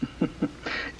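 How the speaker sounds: quiet breathy gloating chuckle; a smug laugh as they read; soft gloating laugh. Acted. Villain gloats over the letter.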